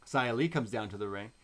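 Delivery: ordinary talk, nothing marked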